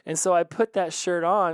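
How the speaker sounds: ordinary talk, nothing marked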